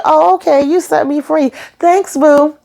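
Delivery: Bloody Mary tossing off a sassy high-voiced thanks. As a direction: flip it off high and careless. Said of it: "Bloody Mary said".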